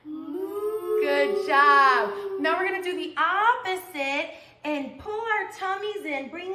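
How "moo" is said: playful sung moo; mooing cow. Pretend. Teacher mooing with children in cow pose, playful moo. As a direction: moo like a happy cow for the kids. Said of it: "Can we say moo?".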